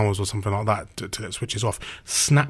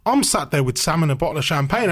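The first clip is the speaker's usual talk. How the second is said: ordinary talk, nothing marked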